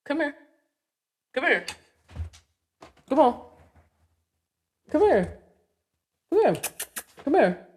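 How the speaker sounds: high, sing-song call to a shy dog; high pet-calling voice; coaxing dog call. Real, not acted. Calling her dog to come.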